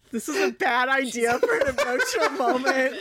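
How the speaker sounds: giggling through the words; laughing. Real, not acted. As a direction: laugh while you say it. Laughing at bad idea.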